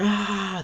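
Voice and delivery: put-on child scream. Kids see monsters.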